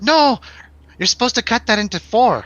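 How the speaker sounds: high, loud protest in an imitated accent; [name]'s accent. Friend correcting how the acid was shared, high imitated accent. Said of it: "I'll do the accent".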